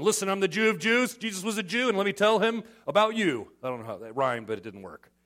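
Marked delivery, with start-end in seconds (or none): 0.0-3.5 loud proud boast; boastful brag. Retold or imitated. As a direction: brag like a big shot. Boastful Paul, louder.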